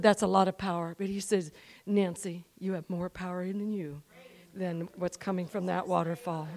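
low, soft voice of God; gentle assurance. Retold or imitated. God gently explains her inner power.

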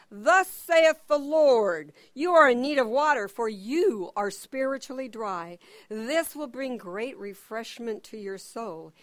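booming prophet spiritualizing a request for water; prophetic proclamation. Acted. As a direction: pronounce it like an Old Testament prophet. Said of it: "He spiritualizes everything".

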